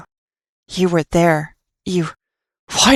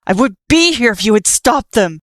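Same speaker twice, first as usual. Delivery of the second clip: angry yell; 4 semitones higher, 4 dB louder than usual. Acted. Nightmare keeps yelling at Frisk.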